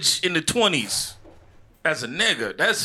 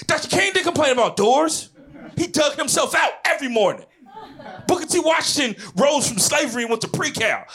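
mock angry lecture; 6 semitones higher than usual. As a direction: scold him like a proud campus official. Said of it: "she got mad".

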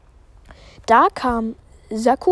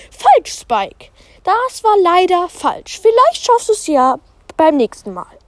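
higher, pitying teacher voice; gentle teacher; 8 semitones higher, 4 dB louder than usual. Acted. Teacher Pam tells Spike he was wrong, higher and kind. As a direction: let him down gently.

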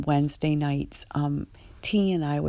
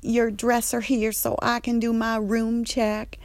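higher, sassy instruction; Andre's voice; 6 semitones higher than usual. Retold or imitated. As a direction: staff member telling T to open her dresser. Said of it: "And Andre's like".

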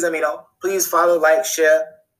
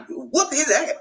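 much higher, egging-on voice; higher, worked-up street talk; hyped-up; 8 semitones higher than usual. Retold or imitated. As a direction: cheer for the beating like a fan. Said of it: "'Cause I was like".

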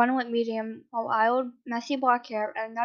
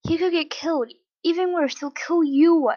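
higher and louder, building warning; voice raising; 6 semitones higher, 6 dB louder than usual. Acted. Father warning his son of danger, rising shout. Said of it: "his voice raising".

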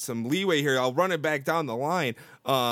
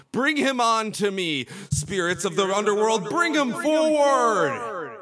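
hammed-up invocation building higher and louder; mock summoning cry; 5 semitones higher, 4 dB louder than usual. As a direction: swell to a long, drawn-out final command.

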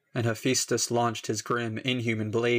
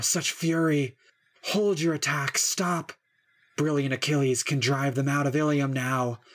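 higher, strained cries begging him to stop; burning river pleading; 4 semitones higher than usual. Acted. River Xanthus begs Hephaestus to stop the fire, high and desperate. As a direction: beg for mercy from the flames, voice climbing. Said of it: "He panted the god's name".

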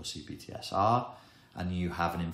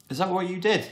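excited parent; 12 semitones higher, 7 dB louder than usual. Acted. Good parent praising the child.

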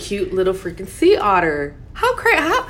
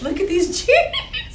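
laughing; 11 semitones higher, slower than usual. Real, not acted. Laughing at her jeans.

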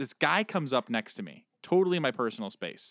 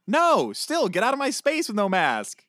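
much higher, louder protest; heated insistence; 10 semitones higher, 7 dB louder than usual. Real, not acted. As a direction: insist hard, no exceptions.

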